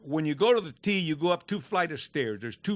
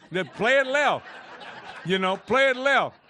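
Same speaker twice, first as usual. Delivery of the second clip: laughing retort; 7 semitones higher, 3 dB louder than usual. Retold or imitated.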